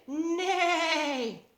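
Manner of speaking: high loud playful neigh; whinny like a horse. Pretend. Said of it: "What do horses say".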